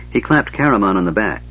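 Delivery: plain reading voice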